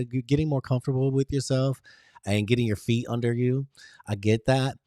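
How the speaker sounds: usual speaking voice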